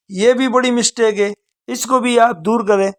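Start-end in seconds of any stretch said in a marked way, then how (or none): none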